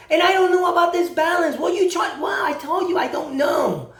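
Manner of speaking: acted insistent denial; insistent debtor. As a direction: stand your ground against the collector, voice lifted.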